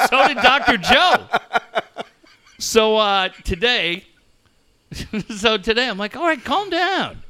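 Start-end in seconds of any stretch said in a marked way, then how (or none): 6.2-7.2 mock exasperation. Protesting at being yelled at.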